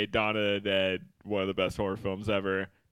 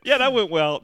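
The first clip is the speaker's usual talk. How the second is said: sarcastic, high and louder; 9 semitones higher, 7 dB louder than usual. Retold or imitated. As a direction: say it with heavy sarcasm. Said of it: "No, it didn't".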